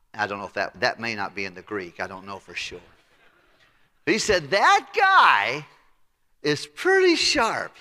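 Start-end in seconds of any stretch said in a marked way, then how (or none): 4.5-7.8 high, loud and slow, each word weighed; grudging praise. Retold or imitated. Boss sizes up his shrewd steward, slow and emphatic. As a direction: drag out the praise.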